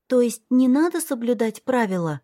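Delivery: put-on high child voice asking why; curious little girl. A narrator's reading. Little girl keeps asking her brother why. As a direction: wonder aloud like a small child. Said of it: "спросила она".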